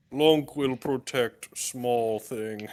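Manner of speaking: low, slow, simple-minded voice; Lunk. Acted. Player speaking as slow Lunk.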